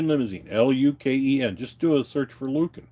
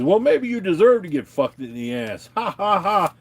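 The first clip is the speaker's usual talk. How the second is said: mocking sneer ending in a higher, put-on cackle; mocking rude caller; 8 semitones higher, 3 dB louder than usual. Retelling the rude bank caller's taunt, mocking and laughing. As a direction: play the caller taunting him, then laughing. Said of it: "he says something really rude".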